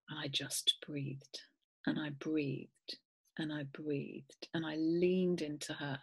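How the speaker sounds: very soft, slow near-whisper; slow it right down, almost breathing the words; hushed, calming. Retold or imitated. Owner breathing with her upset dog, hushed and calming.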